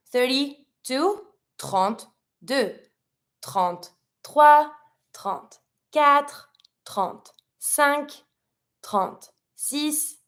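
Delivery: slow, drawn-out counting drill. Shown as a demonstration. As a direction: spell out each number slowly for learners. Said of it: "we do it over again".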